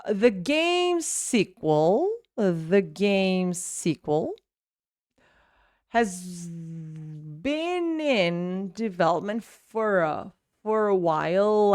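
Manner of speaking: "the game sequel" drawn out, words run together; slow linked demonstration. Shown as a demonstration. Teacher breaking down the sentence, slow linked phrasing. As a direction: stretch each phrase slowly, as a model to copy. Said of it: "I never really stop in the middle".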